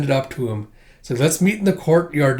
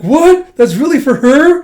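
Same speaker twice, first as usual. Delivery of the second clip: very high, loud question; stunned disbelief; 11 semitones higher, 9 dB louder than usual. Retold or imitated. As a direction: shriek in disbelief. Lackeys shocked at gift.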